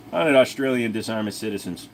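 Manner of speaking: ordinary delivery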